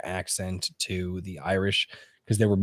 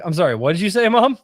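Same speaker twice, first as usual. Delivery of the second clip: high, louder incredulous question to mom; mock disbelief; 11 semitones higher, 6 dB louder than usual. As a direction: retell teasing her with exaggerated puzzlement. Acting out teasing reply to mom.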